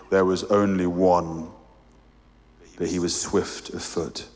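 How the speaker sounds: deep calm voice of a great lion; large deep voice. A narrator's reading. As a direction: voice a huge gentle being, low in the chest. The lion answers.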